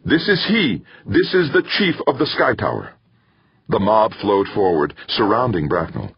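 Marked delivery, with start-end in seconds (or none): 0.1-2.9 high-pitched shouted announcement; shouting. A narrator's reading. Older man identifying the culprit to the mob, shouting. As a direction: call it out to the whole crowd. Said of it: "he shouted".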